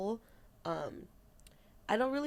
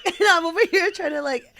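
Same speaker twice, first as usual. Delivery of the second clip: loud, higher, laughing the words out; 10 semitones higher, 12 dB louder than usual. Real, not acted. Laughing, retelling the frozen trip to her friend. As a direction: laugh through the start of the line.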